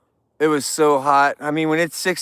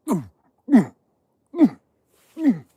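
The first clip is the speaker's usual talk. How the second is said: bear ooh noises; 7 semitones higher than usual. Retold or imitated. Streamer imitating bear sounds to viewers. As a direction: mimic a bear calling out behind you. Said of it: "some bears making bear, like".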